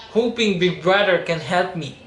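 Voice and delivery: ordinary talk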